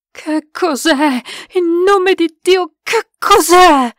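slow, high, building to loud; aghast, drawn-out question; horrified demand. A narrator's reading. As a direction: gasp it out, then cry it aloud. Terrified question after escape.

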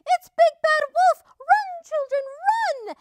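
high, loud panicked warning; squawking hen. A narrator's reading. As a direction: shriek it like an alarmed chicken. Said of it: "squawked Missus Chicken".

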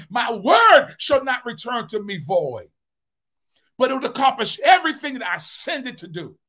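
high, loud, driving preacher's cadence; preaching at full force. Acted. Pastor exhorting his church to trust God, full preaching force. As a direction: preach it out to the congregation, rising and pounding.

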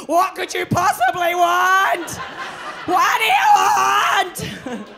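put-on loud, slow Yorkshire accent, weary chain-smoker; old yorkshire accent. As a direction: bark it like a worn-out Yorkshire smoker. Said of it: "six-year-old Yorkshire woman who smokes eighty".

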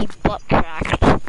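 slow, loud teasing retort; loud mocking drawl. Real, not acted. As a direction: drag it out loud to mock him. Mocking teammate's boast.

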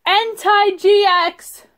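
drawn-out delighted announcement; excited. Real, not acted. Excited card pull.

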